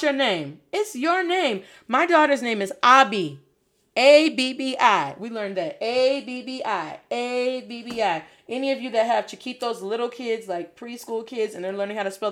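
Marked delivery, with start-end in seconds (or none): 3.9-5.1 slow rhythmic spelling of A B B I; spelled to a beat. Shown as a demonstration. Parent showing how to teach a child name spelling, rhythmic. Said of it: "hook 'em with the beat". 5.8-8.2 rhythmic, repeated letter chant of A B B I; spelled to a rhythm. Shown as a demonstration. Parent showing how kids learn to spell their name, rhythmic. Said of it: "Put their name spelling to a rhythm".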